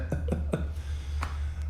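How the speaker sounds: soft exhale of exhaustion; fed-up sigh; long weary sigh. Real, not acted. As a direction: sigh out all the frustration. Fed up after rant.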